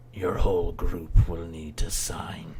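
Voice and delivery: hushed, slow whisper. Acted. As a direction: breathe it out slowly. GM voices a wolf creature.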